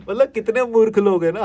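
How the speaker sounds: exasperated. Real, not acted. Ranting at pedantic people, loud exasperation. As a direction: throw up your hands at the foolishness.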